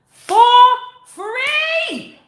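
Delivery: very high, loud crow; triumphant exclamation. Real, not acted. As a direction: sing it out in triumph. Boasting of free top lawyer.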